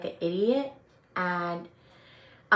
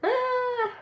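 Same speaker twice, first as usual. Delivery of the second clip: high mock cry. Real, not acted. Mock cry after laugh.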